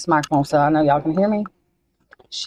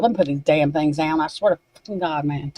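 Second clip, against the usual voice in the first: ordinary delivery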